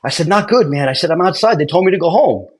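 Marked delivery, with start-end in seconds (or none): 0.3-0.9 higher, worked-up reply; retold alarm. Applicant telling his investigator of trouble, mild alarm. Freaked out, reports bad news. 1.1-2.5 higher, worked-up report; retold alarm. Applicant sent home, calling his investigator, mild alarm.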